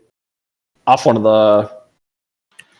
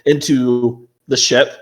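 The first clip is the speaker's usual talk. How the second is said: usual speaking voice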